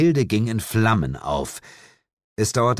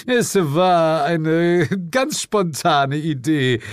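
slow, higher, drawn-out coyness; bashful; 9 semitones higher, 4 dB louder, slower than usual. A narrator's reading. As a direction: draw it out with an embarrassed smile. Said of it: "lächelte verlegen". (verlegen und gedehnt)

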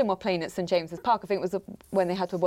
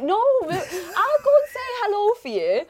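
high, slow, laughing protest; mortified laughing plea; 11 semitones higher, 4 dB louder, slower than usual. Retold or imitated. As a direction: squeal the protest while laughing. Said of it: "I'm like".

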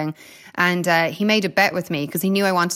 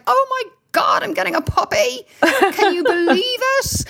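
much higher, laughing with delight; excited; 14 semitones higher than usual. Retold or imitated. Athlete tells reporters after the race about the puppy, thrilled and laughing. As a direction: gush like you just won a puppy.

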